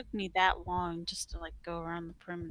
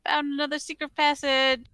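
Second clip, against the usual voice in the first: loud call across a room; yelling across the room; 8 semitones higher, 15 dB louder than usual. Acted. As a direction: shout it out to the others. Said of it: "I yell across the room".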